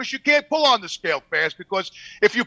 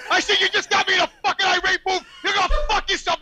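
higher, laughing mock rant; retold losing it; 8 semitones higher than usual. Driver telling off the scale guy, retold rage. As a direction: blow up at the clerk, reenacted. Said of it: "all of a sudden I lost it".

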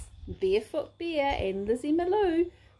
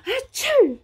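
loud sneeze; 9 semitones higher, 9 dB louder than usual. A narrator's reading. Big sneeze in story.

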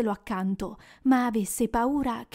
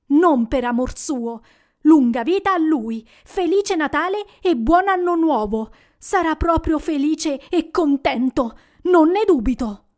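sarcastic toast; 5 semitones higher, 6 dB louder than usual. Acted.

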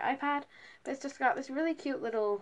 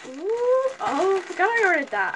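high, loud "ooh" of discovery; pleasant surprise; 7 semitones higher, 7 dB louder than usual. Real, not acted. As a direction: burst out on spotting something forgotten. Spots a forgotten item in the package.

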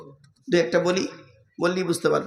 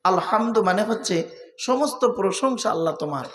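ordinary delivery

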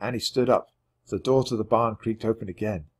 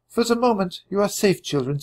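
high, gentle woman's voice; a French farmwife comforting hiding children; Suzette; 10 semitones higher than usual. A narrator's reading. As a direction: reassure the children as a kind mother.

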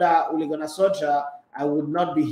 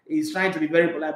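ordinary talk, nothing marked

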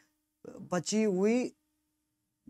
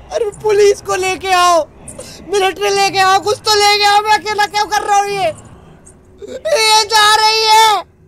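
high loud whiny cry for help; desperate wailing; 8 semitones higher, 8 dB louder than usual. Real, not acted. Gamer alone against the ghost, desperate wailing. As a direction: wail like you're about to cry.

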